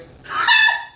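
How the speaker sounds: high, loud cackle; loud burst of laughter. Retold or imitated. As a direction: let a sudden laugh burst out.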